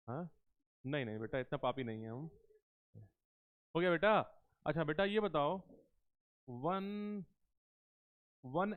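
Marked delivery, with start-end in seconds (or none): none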